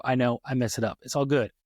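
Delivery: normal talking voice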